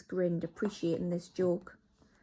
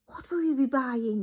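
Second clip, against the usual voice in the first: higher, eager witch question; crying out curious; 6 semitones higher, 4 dB louder than usual. Acted. Witches ask their leader, high eager question. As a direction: beg to know. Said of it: "they cried".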